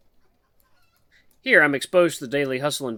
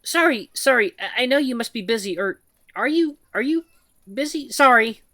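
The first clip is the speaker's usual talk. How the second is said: higher, halting nervous voice of a shy mouse; timid mouse woman; 11 semitones higher, 6 dB louder than usual. Acted. Shy mouse woman nervously calls to the human, halting and high. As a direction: stammer it out like a timid mouse calling for attention.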